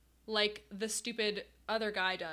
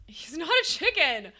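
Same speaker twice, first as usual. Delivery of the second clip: louder, higher outburst of denial; exasperated protest; 5 semitones higher, 6 dB louder than usual. Real, not acted. Streamer argues with chat about the penguin, exasperated protest. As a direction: protest it loudly to the doubters. Arguing with chat over disguise.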